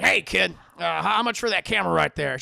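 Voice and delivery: loud boss impression; barked call-out. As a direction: holler a brusque offer at a kid. Jameson calls out to girl.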